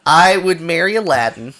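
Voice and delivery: ordinary talk, nothing marked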